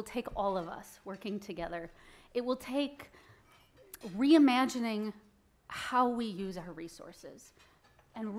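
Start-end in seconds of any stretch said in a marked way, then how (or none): none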